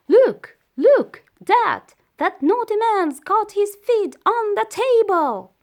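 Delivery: dog shouting to Dad about the intruder; excited shout. A narrator's reading. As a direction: shout for Dad to come and see. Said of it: "Phyllis shouted".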